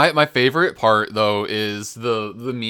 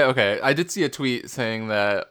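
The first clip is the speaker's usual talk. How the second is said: normal talking voice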